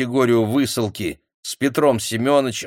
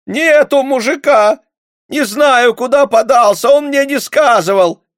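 high, loud frantic woman's cry; desperate cry; 10 dB louder than usual. A narrator's reading. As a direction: protest in panic. Frightened peasant woman denying. (отчаянный крик)

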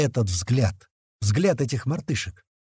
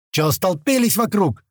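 higher, raised-voice rebuke; irritated scolding; 6 semitones higher, 4 dB louder than usual. A narrator's reading. Doctor scolding onlookers crowding his patient, irritated. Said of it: "зашипел на нас Док".

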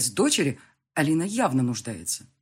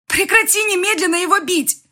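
loud, high-pitched commanding scream; yelling; 10 dB louder than usual. A narrator's reading. Woman screams at narrator to stop hitting rival, yelling. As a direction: shriek an order to stop the beating. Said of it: "Лина просто орала на нас".

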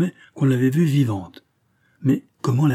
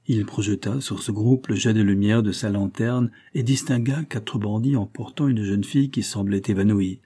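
usual speaking voice

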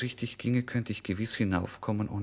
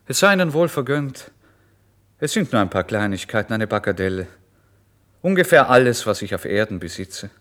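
bitter loud call; 2 semitones higher, 8 dB louder than usual. Acted. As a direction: throw the bitter words after him. Bitter parting words, called loudly.

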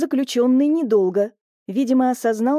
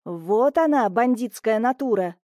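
usual speaking voice

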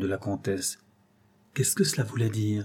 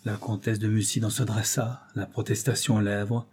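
usual speaking voice

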